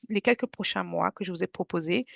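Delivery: ordinary delivery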